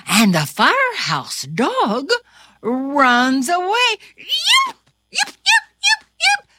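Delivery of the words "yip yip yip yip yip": very high, louder yips; small dog yips. Acted. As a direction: yelp like a scared pup. Dog runs away yipping.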